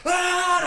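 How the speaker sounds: high, loud "ah" scream; acted scream. Man faking an attack to prank his friend, acted scream. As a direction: yell as if a bobcat is attacking you. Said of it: "And I just go".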